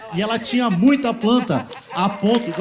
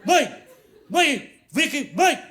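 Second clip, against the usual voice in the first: higher-pitched acted yell for mom; retold calling out; 6 semitones higher, much slower than usual. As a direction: call "mãe" from the gate, re-enacted. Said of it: "comecei a gritar". (chamando a mãe aos gritos, encenado)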